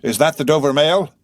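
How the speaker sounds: high, loud hail rising on Mail; shouted enquiry with a questioning rise; called-out question. A narrator's reading. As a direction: call through mist, lifting at Mail. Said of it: "a man's voice called from the mist".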